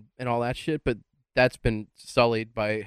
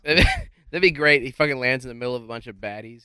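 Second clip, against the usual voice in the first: ordinary delivery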